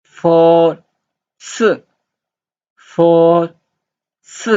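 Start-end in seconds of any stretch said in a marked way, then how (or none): 1.4-1.6 S as a short high falling syllable; falling Mandarin tone on S; fourth tone. Shown as a demonstration. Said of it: "this is fourth tone". 4.2-4.5 S as a short high falling syllable; falling Mandarin tone on S; fourth tone. Shown as a demonstration. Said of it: "this is fourth tone".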